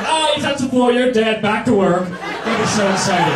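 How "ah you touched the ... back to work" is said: high mock boss catching you out; a playful boss calling it.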